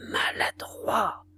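slow, whispered rebuke; murmured. A narrator's reading. Lantenac cursing his own missed cannon shots, murmured. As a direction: murmur it under your breath, drawn out. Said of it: "murmura Lantenac".

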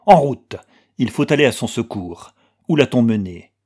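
loud, decisive call to action; urgent. A narrator's reading. Anna rallies Manske to help Axel, urgently. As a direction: launch it loudly, then press on. Rallying companion to rescue prisoner.

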